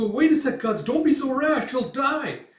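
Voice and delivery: high, loud frantic plea; panicked warning. Retold or imitated. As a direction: beg a friend to stop. Acts Puck warning Guts in panic.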